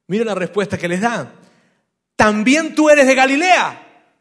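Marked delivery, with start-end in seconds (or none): 2.2-4.2 alto, fuerte y lento, con desprecio; fariseos indignados. Retold or imitated. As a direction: reprocharle como un fariseo ofendido. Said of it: "contestaron ellos". (los fariseos increpan a Nicodemo, gritando con desdén)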